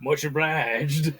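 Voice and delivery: slow Western cowboy drawl; Western cowboy accent. Shown as a demonstration. Showing his Red Dead cowboy accent on request, slow Western drawl. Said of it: "My Western?".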